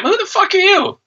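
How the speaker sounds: high, scoffing outburst; mocking the star, a high, scoffing retort; incredulous retort. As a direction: fire it back in high disbelief. Ranting at the celebrity.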